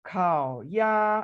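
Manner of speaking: 烤鸭 said with clear Mandarin tones; teacher demo of 烤鸭; careful Mandarin model. Shown as a demonstration. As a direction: model 烤鸭 slowly for learners. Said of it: "इसकी practice करेंगे".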